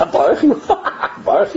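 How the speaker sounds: high-pitched mocking echo with laughter; kids mocking accent. Kids mimicking the prayer leader, mocking high voice. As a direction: mimic the odd accent high, like giggling children. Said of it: "so the kids from the five".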